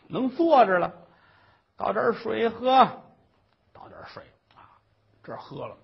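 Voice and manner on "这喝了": ordinary delivery, nothing marked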